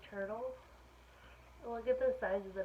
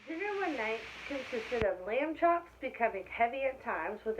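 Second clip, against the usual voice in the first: lively, louder read-aloud voice; story reading to children; 7 dB louder than usual. A narrator's reading. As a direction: read it brightly to a class of kids. Reading picture book aloud.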